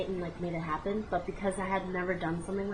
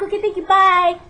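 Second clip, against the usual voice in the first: squeaky, much higher and louder rushed bye; hurried high goodbye; 11 semitones higher, 13 dB louder than usual. Retold or imitated. Rushing out the door after the awkward hookup, squeaky. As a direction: chirp it out and flee. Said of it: "that's exactly how I said it".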